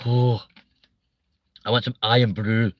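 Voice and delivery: lightly put-on Scottish accent; bad Scottish accent. Shown as a demonstration. Comic has another try at Scottish, lightly faked Scottish accent. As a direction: have a poor go at Scottish. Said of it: "Scottish was shit".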